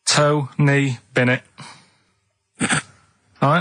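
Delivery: usual speaking voice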